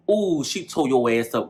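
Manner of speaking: ordinary talk, nothing marked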